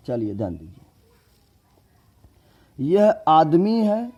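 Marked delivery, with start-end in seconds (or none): 2.8-4.1 high, slow, loud claim; mock quiz claim. Shown as a demonstration. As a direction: play the confused student. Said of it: "ऐसे करके तिरपट बता रहे हैं". (ऊँचे स्वर में धीमा नाटकीय दावा)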